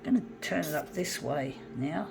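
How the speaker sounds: ordinary talk, nothing marked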